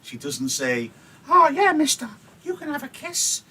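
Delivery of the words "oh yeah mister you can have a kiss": a hammed-up come-on, much higher; mock flirty Juliet. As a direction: squeal it like a cheeky flirt. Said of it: "She teases him".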